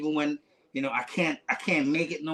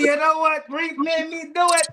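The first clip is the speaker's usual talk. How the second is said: high, loud jokey blaming; a cheeky shout-out that passes the blame; playful mock excuse; 14 semitones higher, 6 dB louder than usual. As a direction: pin it on Reef with a grin in the voice. Jokingly blames Reef for the gift.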